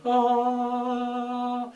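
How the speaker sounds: high, sung angelic ah; heavenly choir ah. Pretend. Heavenly beam of light.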